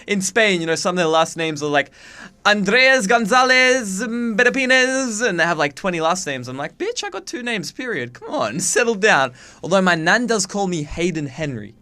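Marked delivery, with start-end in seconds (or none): 2.5-5.3 high, drawn-out mock Spanish accent; exaggerated Spanish accent, stretched and high; mock Spanish names. Mocking long Spanish last names. 6.8-9.3 high little voice of comic indignation; mock sassy retort. Mock retort to long names.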